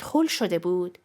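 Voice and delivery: ordinary delivery, nothing marked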